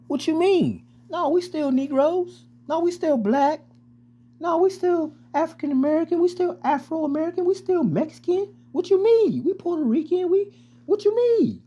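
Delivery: high, far-off mocking voice of confused listeners. Preacher mocks the people who claim other names, high and whiny. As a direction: play the puzzled folks squeaking back. Mocking congregants answering back.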